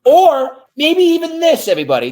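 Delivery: high, loud emphatic burst; excited. Real, not acted. Urging the audience to rethink, excited and loud. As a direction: push it up big and loud. Building to a big point.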